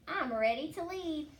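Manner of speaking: 1 eager shout. Acted. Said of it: "shouted Wilf".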